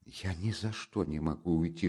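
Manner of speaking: hushed, near despair; very soft confiding murmur; desperate whisper. Acted. Mother confiding to the friend, desperate whisper. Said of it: "шептала она Разумихину чуть не в отчаянии".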